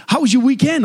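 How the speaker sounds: high, eager small-talk voice; excitable bank teller. Acted. Teller making small talk with a customer, excitable. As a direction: pester brightly like an excitable teller. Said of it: "there's this excitable, um, teller".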